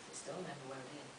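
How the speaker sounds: ordinary delivery, nothing marked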